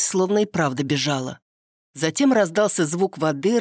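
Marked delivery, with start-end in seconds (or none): none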